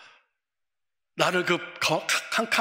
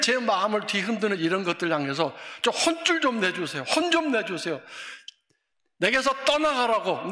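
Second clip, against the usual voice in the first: loud, high crying-out prayer; 7 semitones higher, 5 dB louder than usual. Shown as a demonstration. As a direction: cry out to God with full force. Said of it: "이렇게 부르짖으시기를". (하나님께 부르짖는 큰 소리의 기도)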